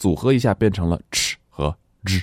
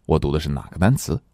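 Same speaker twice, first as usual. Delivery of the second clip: ordinary talk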